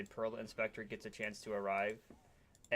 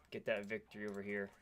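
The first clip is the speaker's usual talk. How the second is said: ordinary delivery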